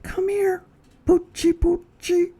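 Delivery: very high cooing dog call; baby-talk whisper. Acted. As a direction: coax the puppy in a sugary squeak. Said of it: "whispered the other".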